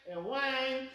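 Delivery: long drawn-out, repetitive whine; mock whining. Telling how a boy kept crying in the corner, drawn-out mock whine. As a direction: stretch each "whine" into a child's wail. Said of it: "he is just whine".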